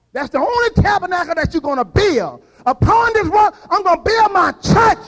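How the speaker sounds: high, loud preacher's build-up; full-force preaching. Acted. Preacher rousing the congregation, loud and high. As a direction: climb up hard as the sermon takes off.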